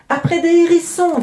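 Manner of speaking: put-on very high, loud children's correction; gleeful young ogresses calling out high; ogresses' interjection. A narrator's reading. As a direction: cut in loudly in a high child voice. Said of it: "disent les ogresses".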